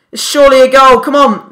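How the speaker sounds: high, loud pleading yell; excited shouting. Real, not acted. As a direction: beg the ball into the net. Sure goal chance.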